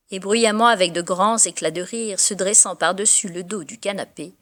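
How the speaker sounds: put-on lively verse reading, lifting on the children's play; storyteller's recital of a poem; poem recitation. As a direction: recite it as a father amused by his children.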